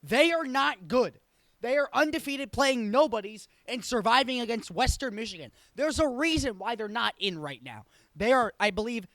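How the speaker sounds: emphatic rant. Real, not acted. Ranting against an overrated team.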